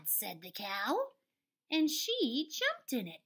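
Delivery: ordinary delivery, nothing marked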